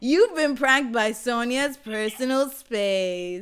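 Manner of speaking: loud, slow triumphant reveal; prank reveal laughing. Real, not acted. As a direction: shout the reveal gleefully.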